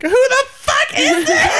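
very high, loud, slow laughing scream; shrieking mock outrage; hammed-up shriek. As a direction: explode into a cackling shriek. [name] screaming on phone.

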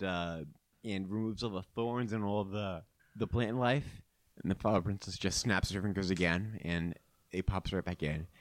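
normal talking voice

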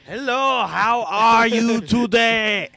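put-on high, slow German accent voice. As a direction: say it like a German hearing aid. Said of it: "repeat whatever is said loudly in a German accent".

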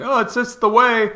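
mock the sheep who just accept it; mocking quote. Ranting vlogger mocks people who accept things, sneering.

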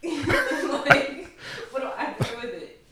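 laughing through the words; loud laugh breaking in. Real, not acted. Laughing at her own point.

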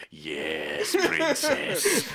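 put-on villain voice, drawn out; mock villain. Retelling a villain addressing the princess, mock villain voice. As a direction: stretch it like a hammed-up villain.

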